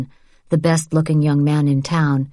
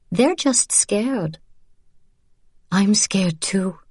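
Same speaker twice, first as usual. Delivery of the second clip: gentle reassurance; 5 semitones higher, slower than usual. A narrator's reading. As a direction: soothe a frightened friend. Women comfort each other.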